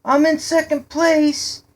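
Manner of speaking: put-on very high, loud boyish boast; gleeful call-out. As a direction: crow it to the others while chasing. Boy calls out during chase.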